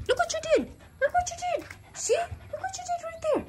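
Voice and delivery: very high, loud mock scolding of a naughty pet; high-pitched scolding to the dog. Real, not acted.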